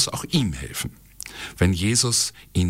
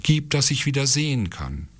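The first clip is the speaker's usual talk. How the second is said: higher, earnest plea; pleading; 7 semitones higher than usual. A narrator's reading. Blind Bartimäus to Jesus, pleading. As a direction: beg in a raised pitch. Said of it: "bat Bartimäus".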